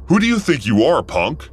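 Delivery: high, loud angry challenge. Retold or imitated. Angry man confronts the cashier, hammed-up hostile. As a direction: snap back at the kid. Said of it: "He stared at me angrily and said".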